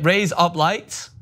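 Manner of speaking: drawn-out Australian raise up lights; Australian accent. Shown as a demonstration. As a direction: raise up lights, slow, with Aussie vowels. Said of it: "you're saying razor blades in an Australian accent".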